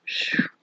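soft, airy "phew"; sigh of relief. A narrator's reading. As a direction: let out a quiet relieved breath. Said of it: "he sighed".

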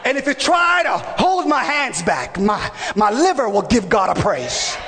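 rising, shouted preaching with long stretched words; preaching at full force. Acted. Preacher rousing his church, fiery shouted preaching. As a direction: whip the congregation into fire.

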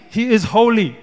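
ordinary delivery

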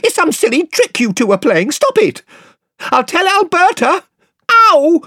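put-on a spoilt boy shrieking in fright; scared, cross boy threatening to tell, squealing; squeaky and bad-tempered. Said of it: "squeaky with fright and bad temper".

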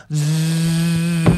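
long droning machine buzz; imitated camera whir. Actor imitating the camera behind the head, droning buzz. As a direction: buzz steadily like a rickety old camera. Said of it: "all I heard was".

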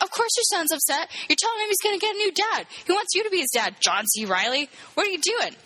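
high-pitched, indignant telling-off; exasperated scolding. Pretend. Viewer scolding a movie dad, exasperated. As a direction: take the foolish dad to task. Teller scolds the film's father.

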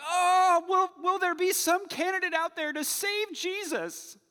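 hammed-up high, loud fretting; mock-worried pleading whine; mock worry. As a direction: parody an anxious churchgoer. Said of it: "we're like".

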